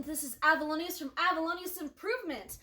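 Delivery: high, loud, lilting host welcome; show intro. Real, not acted. Host welcomes listeners to another interview, bubbly show intro.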